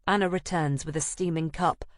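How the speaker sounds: normal talking voice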